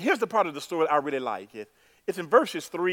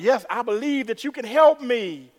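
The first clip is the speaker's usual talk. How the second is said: higher-pitched, earnest acted reply; pleading believer; 7 semitones higher, 5 dB louder than usual. Dangling man answering the voice, acted pleading. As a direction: answer eagerly up to the sky.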